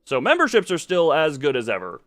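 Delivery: ordinary talk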